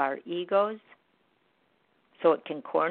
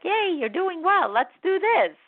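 much higher, louder cheer; cheering inner voice; 11 semitones higher, 4 dB louder than usual. Retold or imitated. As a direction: cheer yourself on like a fan. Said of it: "Then our mind's like".

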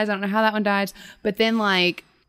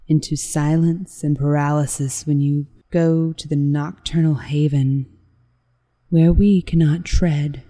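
creepy voice; 5 semitones lower, slower than usual. Acted. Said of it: "I'll do this in the creepy voice".

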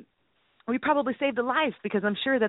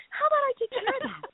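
mock eager agent; faster than usual.

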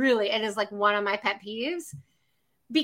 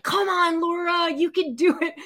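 lightly acted pep talk, higher pitch; cheering yourself on, higher; encouraging inner voice; 6 semitones higher than usual. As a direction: coax yourself on in a raised inner voice. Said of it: "this other little voice that's like".

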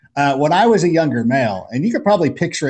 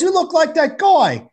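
Australian guy. Retold or imitated. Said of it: "He goes".

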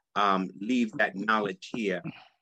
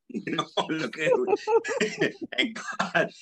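laughing through the words, breaking high. Real, not acted. Guest laughing at his own youthful Pulitzer dream, laughing.

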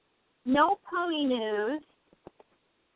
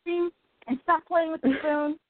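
scolding pet; 6 semitones higher than usual. Retold or imitated. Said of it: "I said".

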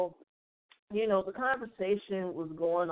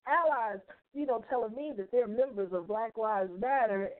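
usual speaking voice

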